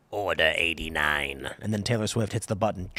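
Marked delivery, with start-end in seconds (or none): 0.1-1.5 deep, slow villain voice; ominous command. Acted. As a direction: intone it like a sinister movie command. Repeats the order as a dramatic line.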